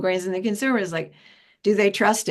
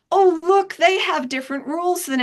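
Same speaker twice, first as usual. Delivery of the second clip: high, louder oh look in mock surprise; 10 semitones higher, 3 dB louder than usual. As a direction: feign discovering a new problem. Mock discovery of new rules.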